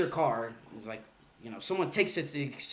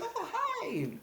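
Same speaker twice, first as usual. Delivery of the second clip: light high breathy-free soft hi, much higher; Margot's reply; 7 dB quieter than usual. Retold or imitated. Retelling Margot greeting him, high and soft. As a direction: mimic a dainty soft hello, pitched up high.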